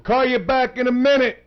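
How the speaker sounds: calling out loudly. Real, not acted. Host to caller who can barely hear, loud holler. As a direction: holler it down a bad phone line.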